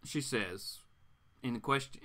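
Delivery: plain reading voice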